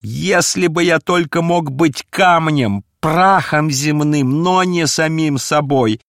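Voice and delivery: high, loud, despairing outburst; tormented, impassioned shouting; anguished cry. A narrator's reading. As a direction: wail in self-loathing, building to single shouted words. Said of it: "закричал несчастный".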